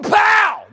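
loud shouted swoosh of a fastball. Retold or imitated. Preacher yelling the sound of the pitch.

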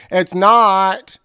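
high, loud exclamation; loud punchline. Real, not acted. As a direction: shout the punchline.

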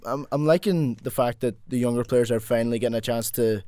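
normal talking voice

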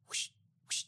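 soft breathy whoosh of a sheet being flipped; whispered whoosh. Retold or imitated. Mimics flipping a drape sheet.

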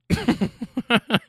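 imitated high wheezy laugh of an old smoker; mock old-man cackle; smoker's cough laugh. Driver imitating an old rider's laugh, in a mock smoker's cackle. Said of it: "laughs with that smoker's cough".

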